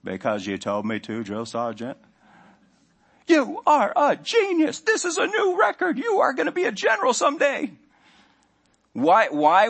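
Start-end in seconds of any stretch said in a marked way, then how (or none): 0.0-2.0 Forrest Gump voice. Retold or imitated. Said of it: "Forrest answers the sergeant". 3.3-7.7 drill sergeant. Retold or imitated. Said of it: "the drill sergeant's voice".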